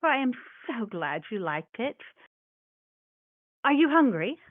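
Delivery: put-on sweet old mouse hostess; kindly granny mouse; grandmotherly mouse voice.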